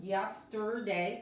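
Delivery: YESTERDAY drawn out, untrilled R with a floating tongue; English R. Shown as a demonstration. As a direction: model YESTERDAY slowly with an English R. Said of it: "La R de inglés nunca se vibra".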